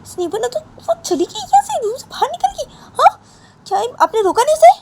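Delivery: very high, loud shrill outburst; furious mother. Acted. Payal shouting at Poonam for not stopping Sonam, very high and loud. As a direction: blast him for letting her go.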